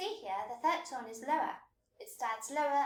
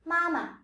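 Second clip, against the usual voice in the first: "Mama" high first syllable, lighter short second; neutral second syllable; 4 semitones higher, 4 dB louder than usual. Shown as a demonstration. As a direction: let the second half of "Mama" fall away short. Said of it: "which is lighter and shorter".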